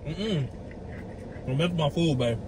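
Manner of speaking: ordinary delivery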